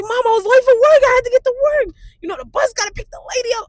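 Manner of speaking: high, loud, whiny excuse-making; mock frantic protest. Daughter defending her speeding to mom, loud protest. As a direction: plead your case at full volume, way up high. Defending herself to scolding mother.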